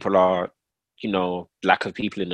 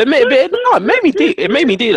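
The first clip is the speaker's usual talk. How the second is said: high, loud laughing protest; 7 dB louder than usual. Real, not acted.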